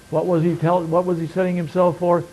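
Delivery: normal talking voice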